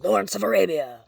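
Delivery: loud, high proclamation; ringing, playful announcement; mock-grand exclamation. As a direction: belt out the title grandly. Mock-grand cry of a film title.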